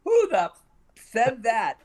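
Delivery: high, loud mock fury; retold star's indignant shout; imitated angry outburst. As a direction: blurt it high, cutting off the swear. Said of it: "And David Jansen went".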